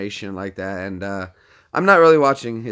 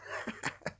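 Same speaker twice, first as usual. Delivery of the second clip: quiet, hushed giggle; hushed little laugh; stifled laugh; 18 dB quieter than usual. Real, not acted. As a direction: snicker softly before the prank. Stifled giggle before prank call.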